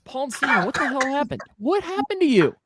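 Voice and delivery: high, stunned questioning; alarmed. Real, not acted. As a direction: ask in disbelief. Friend choking on beer.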